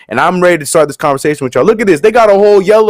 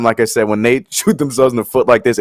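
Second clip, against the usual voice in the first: normal talking voice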